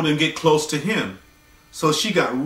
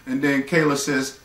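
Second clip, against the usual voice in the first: ordinary talk, nothing marked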